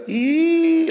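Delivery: drawn-out high squeal on one note; high eee for emphasis. Retold or imitated. Local man explaining the dialect's emphasis, drawn-out high eee. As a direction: demonstrate emphasis with a long rising eee. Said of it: "that's emphasis".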